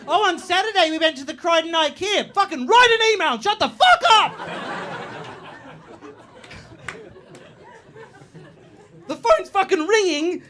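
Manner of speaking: high, loud office blow-up; mock outburst. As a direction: snap at a chatty colleague. Exploding at chatty coworker.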